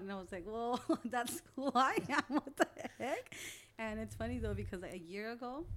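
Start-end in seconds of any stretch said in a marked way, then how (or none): 0.5-3.3 loud, high, slow through laughter; laughing protest. Retold or imitated. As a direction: protest while cracking up. Laughing reply to being compared.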